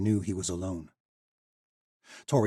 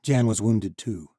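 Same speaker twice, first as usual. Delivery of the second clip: usual speaking voice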